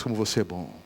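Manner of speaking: tempting devil voice. Acted. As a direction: purr it low like the tempter. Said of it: "Aí vem aquela voz". (voz baixa e sedutora do diabo)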